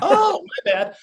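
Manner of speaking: light, high mock-sheepish "oh my bad"; sheepish apology. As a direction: play it as a breezy, embarrassed shrug, only lightly acted. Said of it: "And I go".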